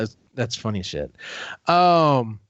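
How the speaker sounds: ordinary talk, nothing marked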